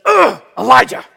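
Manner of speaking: the king fed up at hearing the name; exasperated king. Acted. King annoyed on hearing Elijah is back, loud groan. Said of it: "and the king says".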